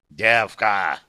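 loud growled insult. A narrator's reading. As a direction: snarl it out loud. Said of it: "прорычал Ухеш".

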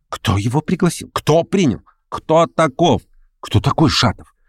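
pelting questions. A narrator's reading. Meeting members demand who invited Shatov, questions pouring out. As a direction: fire questions from all sides. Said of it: "сыпались вопросы".

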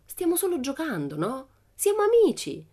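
put-on high, innocent girl voice, rising; innocent Pippi. Pippi calms the angry police, high innocent voice. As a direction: sweet-talk them like playmates.

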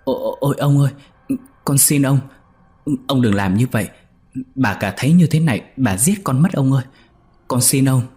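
put-on a terrified servant girl begging her master; desperate cry for mercy; frightened pleading. A narrator's reading. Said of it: "Con Nhị kêu lên".

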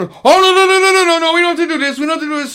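high, loud string of hasty protests, easing in volume; high-pitched retold alarm; mock frantic pleading. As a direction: imitate someone desperately heading off a fight. Retold coworker hurriedly backing them down.

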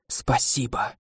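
barely voiced breathy thanks; whisper. A narrator's reading. A grateful seer whispers her thanks to the hero. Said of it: "шепчет видящее".

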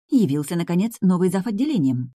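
ordinary delivery

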